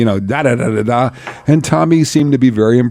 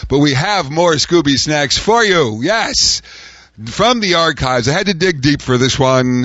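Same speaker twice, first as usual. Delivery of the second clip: drawn-out showman's build-up to the act; radio show introduction; 4 semitones higher, slower than usual. Acted. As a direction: present the comedian, stretching the big words. Introduces an archive comedy clip.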